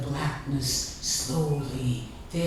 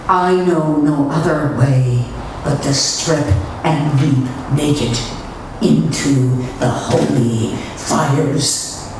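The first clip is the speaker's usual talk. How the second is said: loud, fervent declaration; rising to a shout; 3 semitones lower, 11 dB louder than usual. Acted. Nun declaring her calling to the poets, loud and fervent. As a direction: proclaim it to the rafters, burning with zeal. Climactic declaration, much louder.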